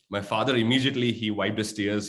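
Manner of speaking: ordinary delivery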